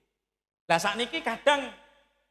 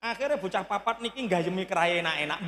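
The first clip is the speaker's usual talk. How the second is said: ordinary delivery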